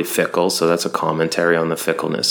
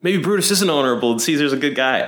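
mock crowd realizing; 7 semitones higher than usual. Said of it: "the citizens are like".

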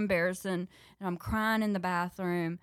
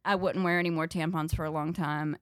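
ordinary delivery

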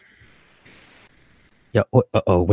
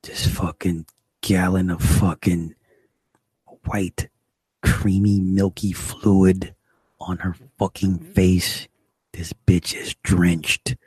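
slow emphasis; slower than usual. Acted.

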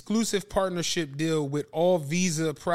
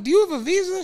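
much higher and louder, hopeful plea; desperate question; 12 semitones higher, 5 dB louder, faster than usual. Retold or imitated. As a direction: ask it like your last hope. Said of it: "I'm like".

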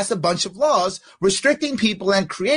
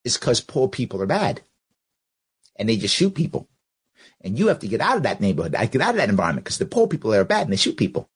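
mock paraphrase; 9 semitones lower, faster than usual. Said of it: "That's what she's saying".

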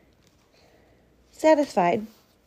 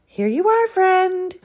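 high, cheery badger greeting; jolly, high-pitched gift-giving; merry; 12 semitones higher, 4 dB louder than usual. A narrator's reading. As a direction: sing out merrily in a high voice. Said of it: "said Badger merrily".

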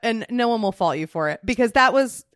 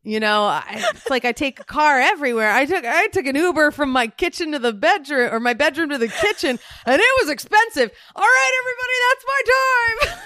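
mock stand-up routine; 5 semitones higher than usual. Retelling the comedian's one joke, hammed-up stand-up building to a shout. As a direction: ham up the comic's set, building to a yelled sign-off. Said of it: "I could even do it now".

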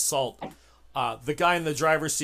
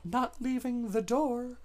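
high, slow mock refusal; slow, high-pitched impersonation; imitating a player's character; 8 semitones higher, 5 dB quieter, slower than usual. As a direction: draw it out high, voicing someone who won't budge. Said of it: "Catherine's like".

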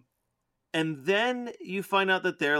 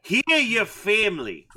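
put-on Australian accent; 5 semitones higher than usual. Co-host adds more shaman lines, Australian accent. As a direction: ham up the Aussie vowels. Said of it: "killing with the Australian accent".

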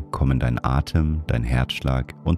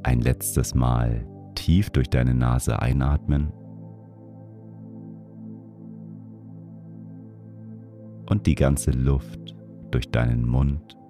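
guided meditation; 3 dB quieter than usual. Acted. Leads a breathing meditation.

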